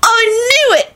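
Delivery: very high, gleeful cry; delighted shriek. A narrator's reading. Boy learning his parentage from the elf, delighted shriek. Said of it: "Timothy shrieked delightedly".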